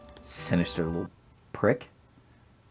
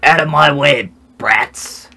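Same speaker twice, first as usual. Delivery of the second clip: gruff shout; 4 semitones higher, 6 dB louder than usual. Acted. Voicing a rude game character.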